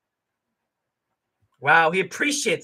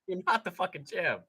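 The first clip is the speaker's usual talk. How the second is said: ordinary talk, nothing marked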